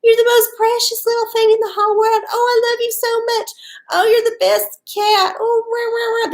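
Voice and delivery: put-on high, fast cooing baby voice; baby talk to a pet. Owners cooing baby talk at their giant cat. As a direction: gush over the cat in sugary baby talk. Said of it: "Mostly it's just them making baby noises".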